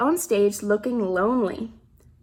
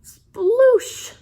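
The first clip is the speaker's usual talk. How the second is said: playful sound effect; 10 semitones higher, 6 dB louder than usual. Acted. Reader voices sound effect.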